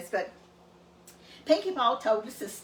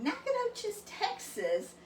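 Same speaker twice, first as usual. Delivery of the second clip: incredulous; 10 semitones higher, 5 dB quieter, slower than usual. Retold or imitated. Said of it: "he didn't believe me".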